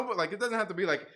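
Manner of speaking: ordinary talk, nothing marked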